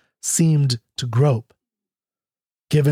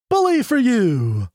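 booming, higher cheer of praise; thundered praise; 8 semitones higher than usual. A narrator's reading. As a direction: boom it out from the dark room. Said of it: "thundered Whispering Ben".

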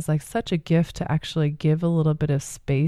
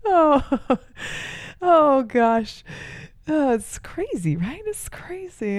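laughing; 10 semitones higher, slower than usual. Real, not acted. Laughing at own joke.

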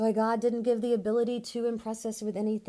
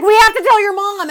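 much higher, much louder urgent cry; frantic yell; 13 semitones higher, 22 dB louder than usual. Retold or imitated. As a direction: shout it in a panic. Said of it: "And she's like".

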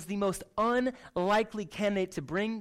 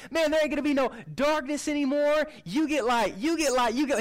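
higher, hyped giveaway shout; Oprah giveaway; 7 semitones higher, 4 dB louder than usual. Retold or imitated. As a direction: hand out light like a talk show giveaway. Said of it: "he's pulling an Oprah".

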